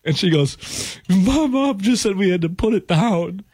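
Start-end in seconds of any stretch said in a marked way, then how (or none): 1.1-3.4 higher, slow put-on weepy voice; mock crying. Bartender reads watch news from mom, mock tearful. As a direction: wail it slowly, imitating someone in tears. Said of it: "she starts crying".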